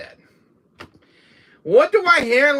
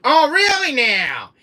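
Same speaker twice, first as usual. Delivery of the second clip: Jack's voice; 9 semitones higher, 6 dB louder, slower than usual. Retold or imitated. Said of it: "he's like".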